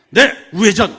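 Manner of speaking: loud barked confirmation; loud military reply. Retold or imitated. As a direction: shout it back like a soldier driver. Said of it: "이렇게 반복하게". (군대식으로 크게 복창하는 운전병)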